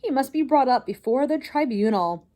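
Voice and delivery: high, loud furious demand; furious shout. Acted. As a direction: bellow it, demanding punishment. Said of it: "shouted Lester".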